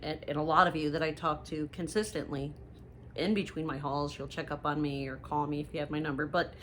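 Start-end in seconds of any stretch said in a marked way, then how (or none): none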